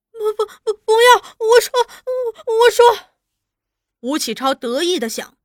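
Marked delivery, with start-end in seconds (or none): 0.1-3.1 high, loud, sobbing begging; wailing plea. A narrator's reading. Girl begging interrogator before burning, wailing. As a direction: stammer through tears in terror. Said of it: "银花儿已哀哀地求着".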